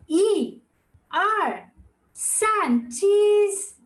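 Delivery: drawn-out count rising to cheese; photo countdown. Real, not acted. Teacher counting in a group screenshot, called-out countdown. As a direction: count each beat separately, lifting the last.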